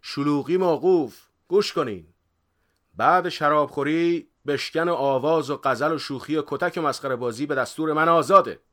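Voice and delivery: high, loud ringing decree; booming master laying down the law; shouted proclamation. A narrator's reading. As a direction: shout the rules over a rowdy crowd. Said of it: "بغوث داد زد".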